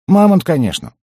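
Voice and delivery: high, loud matter-of-fact answer; high-voiced goblin explanation; squeaky goblin. A narrator's reading. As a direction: pipe up as if it's obvious. Goblin explains to irritated gnome.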